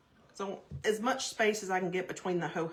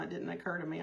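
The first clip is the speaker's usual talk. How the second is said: usual speaking voice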